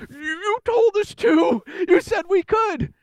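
high, slow protesting shout; indignant teens. Retold or imitated. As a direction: insist like a wronged kid. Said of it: "And they're like".

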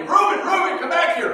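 put-on high, loud call after a boy; yelling a name. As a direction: shout after him to come back. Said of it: "She started yelling at me".

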